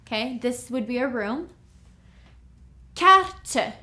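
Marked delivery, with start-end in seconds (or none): 2.9-3.7 Carte, punched higher and louder; emphatic teaching model of Carte; bright emphatic French. Shown as a demonstration. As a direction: Carte said high with a crisp final t. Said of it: "This is a map".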